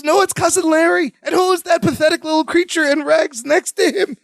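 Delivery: high-pitched mock pity; manipulative Jennifer. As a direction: lay on the pitiful scene in a lightly put-on high voice. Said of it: "she pulls the Larry bit".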